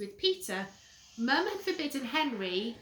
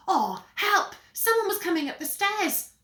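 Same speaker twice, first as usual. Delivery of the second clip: high, loud alarm; sudden panic at footsteps; panicked; 9 semitones higher, 11 dB louder than usual. Acted. Henry hearing Peter on the stairs, loud panic.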